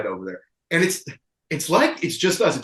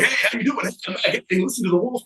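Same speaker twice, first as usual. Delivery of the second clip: a lightly voiced rowdy greeting, pitched higher; loud party guy; 6 semitones higher, faster than usual. Narrator mimicking his usual rowdy bar greeting, lightly pitched up. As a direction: play the friendly loud one at the bar. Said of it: "the loud one".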